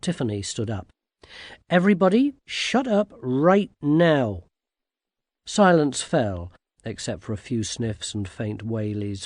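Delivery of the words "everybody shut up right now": louder start, slowing to firm command; commanding girl. A narrator's reading. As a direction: order the crowd silent, word by word. Girl silences wailing crowd.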